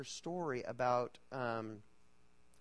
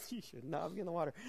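husband's line; 3 semitones higher, 4 dB quieter, faster than usual. Retold or imitated. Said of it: "and he's like".

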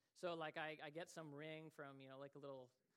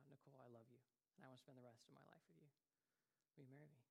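much softer, hushed and tender; tender proposal; 2 semitones lower, 15 dB quieter, much faster than usual. Retold or imitated. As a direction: say it like the big question to her. Retelling his marriage proposal.

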